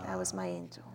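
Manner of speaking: very low, barely voiced murmur; retold softly, deep and fading; hushed reverence. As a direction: let it sink to a reverent hush. Mother's cherished saying, repeated softly.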